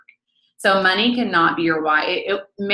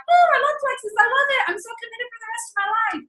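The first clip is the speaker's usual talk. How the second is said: very high squealed enthusiasm; mock over-the-top devotion, sky-high pitch; gushing high. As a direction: gush in a hammed-up squeal. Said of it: "talking about highs and lows".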